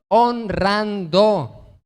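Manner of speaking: Honrando, syllables held long and emphatic; drawn-out answer. Acted. Preacher drives the point home to the church, slow and deliberate. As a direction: stretch Honrando out very slowly.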